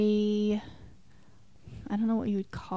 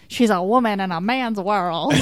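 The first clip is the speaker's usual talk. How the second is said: mock dramatic tagline; 7 dB louder than usual. Mocking the film's tagline.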